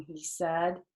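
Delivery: ordinary delivery, nothing marked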